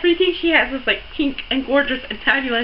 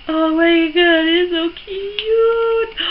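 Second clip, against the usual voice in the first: high, drawn-out gushing; real delighted cooing over something cute; adoring squeal; 4 semitones higher, much slower than usual. Real, not acted. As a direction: stretch the last word in a high squeal.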